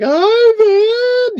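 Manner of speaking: high, loud panicked call of a name; startled cry. Retold or imitated. Wife jolted awake by earthquake calling her husband, high alarmed shout. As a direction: yelp his name in a fright.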